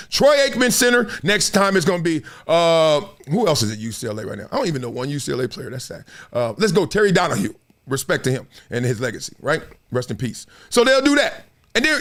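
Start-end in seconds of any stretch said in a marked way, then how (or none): none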